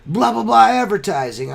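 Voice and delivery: retold cheery sales greeting; chipper caller. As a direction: play the phone rep's breezy hello. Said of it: "one day he called from a different number".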